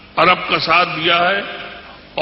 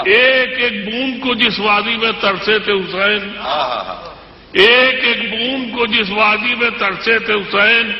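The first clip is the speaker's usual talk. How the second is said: measured, declamatory poetry recital; stately nazm recitation; 4 semitones higher than usual. Acted. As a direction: recite each couplet with weight. Recites couplet.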